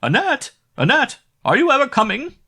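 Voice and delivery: high, loud shrill call up the stairs; screaming from below. Acted. The innkeeper's wife screaming for Annette from below. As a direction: yell her name down the house. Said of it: "screamed from the bottom of the stairs".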